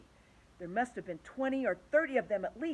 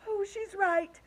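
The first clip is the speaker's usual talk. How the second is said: high raised despairing shout; 11 semitones higher, 5 dB louder, slower than usual. A narrator's reading. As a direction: call it out in panic. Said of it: "shouted Ladybug".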